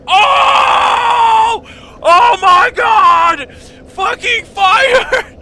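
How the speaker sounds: high, loud panicked shrieking; panicked scream. Retold or imitated. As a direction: scream in shock at the fire. Retelling discovering PC on fire.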